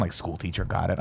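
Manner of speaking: ordinary talk, nothing marked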